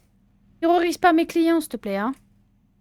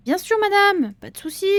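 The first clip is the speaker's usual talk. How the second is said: eager shopkeeper; 8 semitones higher, 6 dB louder than usual. Acted.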